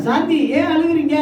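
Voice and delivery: high-pitched appeal to each person in turn; calling by name. Acted. Voices Jesus asking a congregant.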